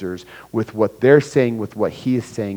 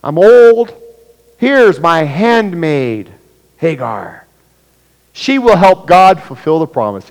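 put-on much higher, louder old woman offering her handmaid; Sarah speaking; 6 semitones higher, 12 dB louder, slower than usual. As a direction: play a desperate old wife handing over her maid. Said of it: "she says".

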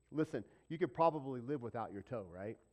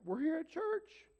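high, slow, self-satisfied sing-song; hammed-up holier-than-thou voice; mock smug churchgoer; 5 dB louder than usual. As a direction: lampoon the proud pew-sitter.

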